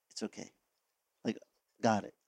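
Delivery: soft, hushed reassurance; barely above a breath, comforting; gentle reassurance. Retold or imitated. God comforting a struggling believer, hushed and gentle. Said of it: "he goes".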